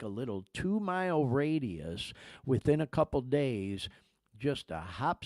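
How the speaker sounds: ordinary talk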